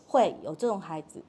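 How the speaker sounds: ordinary talk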